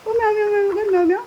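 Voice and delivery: high, loud mimicked meows; cat meow imitation. Shown as a demonstration. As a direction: prompt a parrot with bright cat calls. Prompts parrot to meow.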